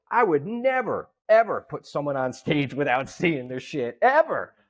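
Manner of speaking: NEVER and the final EVER punched high; emphatic insistence. Real, not acted. Producer stressing his hard rule.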